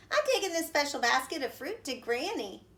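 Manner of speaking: fast, bright reply; eager little girl. A narrator's reading. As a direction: rattle it off eagerly. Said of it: "Little Red replied".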